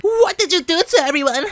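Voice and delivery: high, mock-alarmed young hero voice; alarmed Takeru. Retelling how Takeru confronts Shigeru, high mock alarm. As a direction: pitch up as the shocked hero. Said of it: "Takeru's like".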